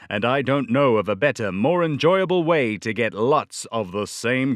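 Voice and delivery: put-on upbeat old-time sponsor pitch; lively vintage commercial voice; radio ad read. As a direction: sell the cereal like a 1938 radio ad. Reads vintage cereal ad.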